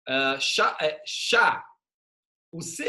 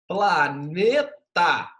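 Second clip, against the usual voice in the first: "Planeta" said very slowly, split into its syllables; 5 dB louder, much slower than usual. Shown as a demonstration. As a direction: stretch out "Planeta" to show the syllables. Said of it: "Em cada sílaba temos uma vogal".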